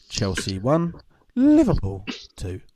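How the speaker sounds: first team plain, second team sung out slowly; results announcer lilt. Retold or imitated. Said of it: "he sings the second team".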